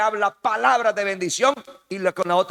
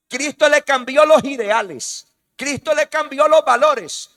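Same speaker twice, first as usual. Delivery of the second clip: each line louder and higher, climbing to a full-force cry; building preaching; 5 semitones higher, 8 dB louder than usual. Acted. Preacher thunders to the congregation, rising to full force. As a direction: preach it up to a roar.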